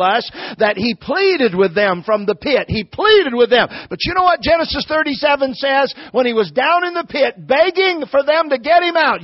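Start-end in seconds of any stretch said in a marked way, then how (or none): 3.9-9.2 high, urgent pulpit build; impassioned preaching. Real, not acted. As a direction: lift the pitch to set up the blow.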